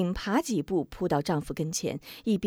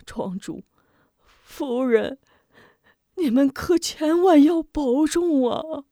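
rising into a higher, louder tearful plea; 7 semitones higher, 5 dB louder than usual. Acted. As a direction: beg them through tears. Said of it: "流着泪道". (老泪纵横地哀求)

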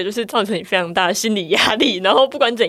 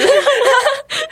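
squealing laugh; high giggle rising. Real, not acted.

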